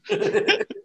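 high burst of laughter; laughing. Real, not acted. Game master breaks after a son joke, laughing. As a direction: crack up at your own joke.

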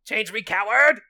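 loud, high demand; mock shout. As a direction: yell it at him, hammy. Shouted Bella parody.

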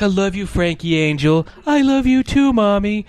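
put-on high sweet-talk, rising into a little-kid voice; doting mother and child. Mother and young son trading affection, high imitated voices.

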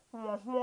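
muffled wah-wah mumbling; Charlie Brown teacher. Retold or imitated. Teacher heard as only tone and no message, mumbly wah-wah imitation. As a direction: imitate speech as soft, wordless muted-horn tones. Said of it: "the Charlie Brown teacher was always like".

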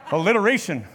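short high laugh then the word; amused self-mocking laugh. Real, not acted. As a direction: laugh at yourself, then name it. Laughs at own alliteration.

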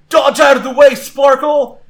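high shouted warning; calling back. Acted. Trainer warns Sparkle of incoming attack, shouting back. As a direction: shout an urgent dodge order. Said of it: "Ethan calls back".

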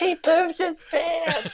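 high, drawn-out mock screech; mock scream. Horrified kid tattles on cousin, high mock scream. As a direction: stretch it into a high imitated cry. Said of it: "And they're all screaming".

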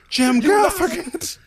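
high, slow, drawn-out announcement of the name; mock announcer shout. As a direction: call out the name like a grand reveal. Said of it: "just be like".

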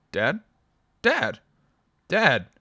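calling into a dead phone line. A narrator's reading.

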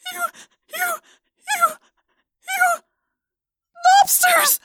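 high, strained stammer; strangled voice. Acted. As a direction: choke out each word. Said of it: "she managed to say".

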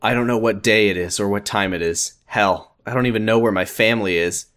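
ordinary delivery

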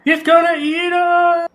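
high, slow terrified shriek; panicked scream. Acted. GM voices panicked crowd.

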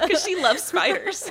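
high bursting laugh through words. Real, not acted. Laughing at friend's fear.

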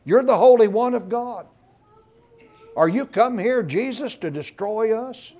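high, loud terrified cry of a demon. Retold or imitated. Unclean spirit cries out to Jesus in the synagogue, loud high terrified cry. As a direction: shriek it out like a cornered devil. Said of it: "he, he cries out".

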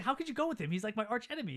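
high-pitched incredulous protest; mock indignation. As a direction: act out a wounded, disbelieving complaint. Will protests to his friend.

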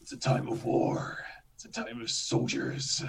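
attempted epic narrator, half whispered; hushed movie-trailer intro, voice giving out; gravelly trailer narrator voice. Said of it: "let's see if I can do the voice".

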